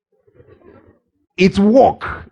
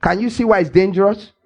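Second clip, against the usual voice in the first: normal talking voice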